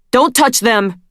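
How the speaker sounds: loud roar. A narrator's reading. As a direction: roar it in fury. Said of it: "he roared".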